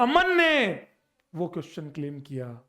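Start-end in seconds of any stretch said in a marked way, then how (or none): 0.0-0.9 high, loud, stretched proclamation; ringing call, pitched way up; triumphant reveal. Real, not acted. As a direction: shout the name as the big reveal. Dramatic reveal of the student's name.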